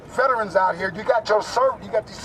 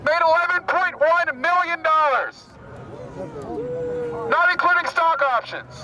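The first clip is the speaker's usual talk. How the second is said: rally speech declamation; 8 semitones higher than usual. Real, not acted. Activist addresses an Occupy rally, declaiming phrase by phrase. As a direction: declaim in short phrases with long pauses.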